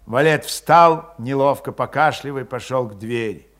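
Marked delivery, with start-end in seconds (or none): none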